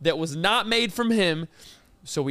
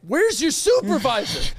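indignant demand; 5 semitones higher than usual. Pretend. To the corn dog stand, outraged customer demanding a manager.

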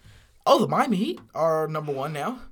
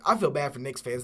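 ordinary talk, nothing marked

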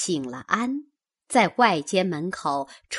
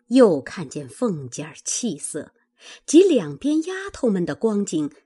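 ordinary delivery, nothing marked

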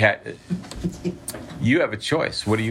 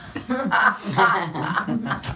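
amazed laugh; 13 semitones higher than usual. Retold or imitated. Roommate amazed at answered prayer.

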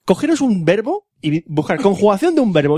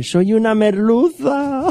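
high, slow, drawn-out fish voice; disguised fish; 5 semitones higher, much slower than usual. Acted. Cod in trench coat posing as hake, drawn-out put-on voice. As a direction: claim to be someone else, slowly and high. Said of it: "lleva gabardines sombrero y dice".

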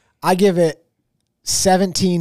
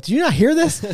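higher-pitched incredulous question; mock disbelief; 10 semitones higher than usual. Friends replying to his late news, higher mock disbelief. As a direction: mimic friends astonished you hadn't heard.

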